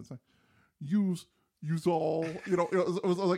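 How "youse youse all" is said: put-on slow, louder Brooklyn accent with a Southern twist; a Brooklyn voice stretched into a Southern y'all; Brooklyn-Southern mix accent. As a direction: drag it out like a Brooklyn mom gone Southern. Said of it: "my mother's Brooklyn accent".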